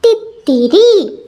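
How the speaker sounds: തിത്തിരി called out slowly and drawn out; said out loud. Shown as a demonstration. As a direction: stretch തിത്തിരി for the children to repeat. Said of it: "ഉറക്കെ പറഞ്ഞോളൂ".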